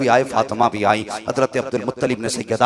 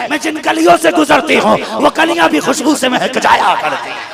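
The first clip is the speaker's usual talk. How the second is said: higher and louder, building to a cry; rising sermon climax; 8 semitones higher, 8 dB louder than usual. Acted. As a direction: build the preaching to a crescendo. (ऊँचे सुर में चढ़ता हुआ जोशीला बयान)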